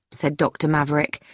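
ordinary delivery, nothing marked

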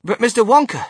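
high, loud, worried appeal; anxious. A narrator's reading. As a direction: call out anxiously as a concerned child. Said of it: "said Charlie Bucket anxiously".

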